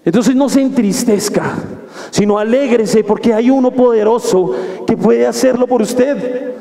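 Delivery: normal talking voice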